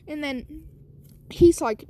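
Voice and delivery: ordinary delivery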